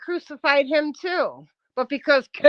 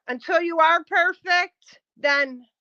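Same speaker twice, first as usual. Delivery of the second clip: normal talking voice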